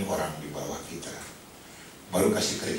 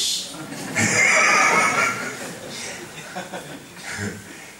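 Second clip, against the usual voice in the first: 1 big laugh; 8 semitones higher, 5 dB louder than usual. Real, not acted. Audience/speaker laughs at joke.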